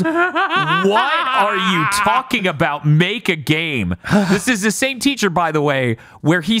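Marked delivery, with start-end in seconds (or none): 0.0-3.9 higher, slow, laughing disbelief; class in disbelief. Retold or imitated. Whole class throws the teacher's order back, laughing in disbelief. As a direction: echo the order back like it's absurd. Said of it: "everyone in the class just goes".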